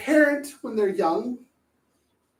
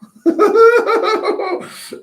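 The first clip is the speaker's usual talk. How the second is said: laugh; 13 semitones higher, 8 dB louder than usual. Real, not acted. Speaker laughs at own joke.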